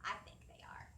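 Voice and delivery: whispered aside; hushed little answer. Acted. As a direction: confide it in a whisper.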